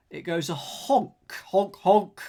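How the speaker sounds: goofy honking noise; silly clown. Pretend. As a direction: honk like a clown horn. Imitating a horn.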